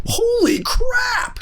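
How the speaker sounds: very high, slow, stretched shout; mock stunned shout. Reacting to the geneticist friend, high slow shout. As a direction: yell it out in drawn-out shock. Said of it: "I'm like".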